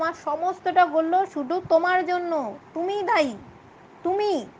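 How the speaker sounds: high, loud, slow accusing shout; angry blame. Acted. Father blaming daughter for driving suitor away, angry. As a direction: point the finger hard.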